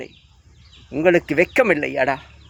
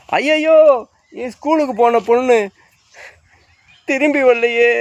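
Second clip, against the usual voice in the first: mock wailing lament; 7 semitones higher than usual. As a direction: ham up a parent's cry over a missing daughter. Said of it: "அப்படின்னு புலம்புறீங்களா?". (கேலியான உயர்ந்த குரல் ஒப்பாரி)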